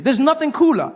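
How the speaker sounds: usual speaking voice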